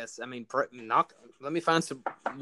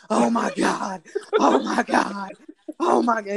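higher, louder mock scream of "oh my God"; retold screaming; 8 semitones higher, 8 dB louder than usual. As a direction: imitate a man yelling alone in his truck, half laughing. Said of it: "I'm screaming it in my truck".

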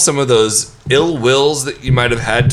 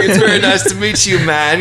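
higher, quick polite greeting; mock meeting; 6 semitones higher than usual. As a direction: play a quick hello to an idol. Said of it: "nail your [name] impression".

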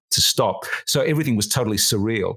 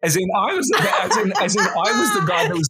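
laughing; 7 semitones higher than usual. Real, not acted. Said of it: "laughing commentary".

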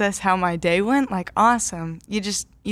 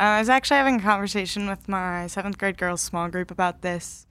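ordinary talk, nothing marked

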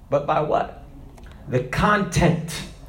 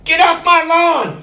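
put-on high, loud cranky yell at kids; old man chasing kids off his grass; angry old man; 11 semitones higher, 5 dB louder than usual. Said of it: "God's an angry old man".

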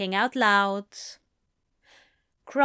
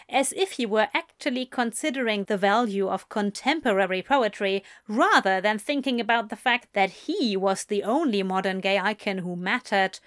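plain reading voice

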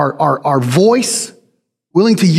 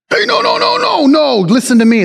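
high-pitched, agitated mock protest; mock arguing; 7 semitones higher than usual. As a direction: cut in with a high string of frantic objections. Mock heated argument, not peaceable.

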